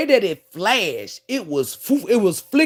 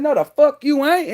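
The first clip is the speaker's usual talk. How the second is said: higher, louder flat refusal; defiant retort; 7 semitones higher, 4 dB louder than usual. Retold or imitated. To the repo lady, defiant no. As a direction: shut her down hard. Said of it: "I said".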